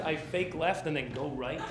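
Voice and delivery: normal talking voice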